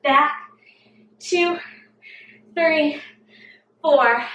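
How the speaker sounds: usual speaking voice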